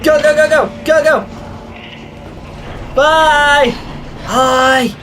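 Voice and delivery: high, loud frantic urging; excited shouting. Real, not acted. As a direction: shout it while running away. Fleeing, shouting.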